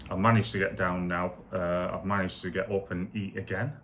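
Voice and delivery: normal talking voice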